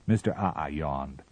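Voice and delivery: ordinary delivery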